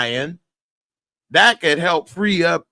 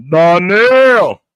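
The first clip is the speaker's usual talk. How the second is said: louder, higher call of the name; calling Darnell; 6 semitones higher, 9 dB louder than usual. Shown as a demonstration. Showing off the impression, a laughing loud Darnell. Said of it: "my Darnell impression".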